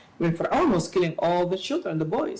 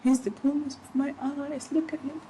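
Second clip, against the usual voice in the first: adoring mother figure; 8 semitones higher than usual. Retold or imitated. Pharaoh's wife cooing over the infant, lightly voiced and higher. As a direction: coo over the baby in a raised voice. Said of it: "she said".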